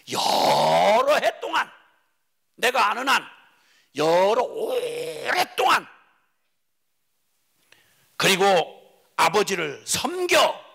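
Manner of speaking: 느리고 크게 울먹이며 외쳐; 억울함을 쏟아내듯 길게 끌어. Acted. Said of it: "막 분노를 쏟아부었습니다". (아버지께 섬김을 호소하며 울먹이는 큰 외침)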